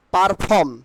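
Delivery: "পারফর্ম" said par-phom with a sounded r; the wrong "পারফর্ম", r clearly in "par"; common mispronunciation. Shown as a demonstration. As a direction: say "পারফর্ম" with the r after the first vowel. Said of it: "আমরা কি বলি?".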